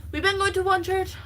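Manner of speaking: ordinary delivery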